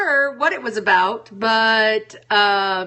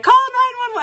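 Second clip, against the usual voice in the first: high urgent cry to call nine one one; mock panicked plea; sudden high call for help; retold call for help; 13 semitones higher, 4 dB louder than usual. Calling for help in dream.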